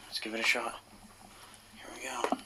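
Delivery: low hushed whisper. Real, not acted. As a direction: whisper it under your breath. Whispering before EVP session.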